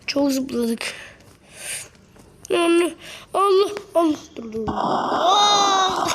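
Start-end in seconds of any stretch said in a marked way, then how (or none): 4.7-6.1 shouted yell of fright; falling scream. Real, not acted. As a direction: cry out in alarm. Falling from height.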